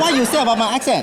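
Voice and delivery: put-on broken Korean-accented English, half laughing. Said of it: "with this accent?".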